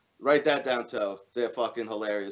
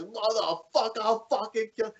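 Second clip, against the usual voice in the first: quoted tirade, pitched way up; enraged dad; 8 semitones higher than usual. Retold or imitated. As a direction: imitate the prank caller's strained rage. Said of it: "a C D where this guy's".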